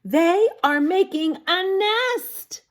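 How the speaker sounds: high, slow answer voice; child answering the teacher; high child's answer. Acted. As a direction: say it like an eager child. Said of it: "If you said this".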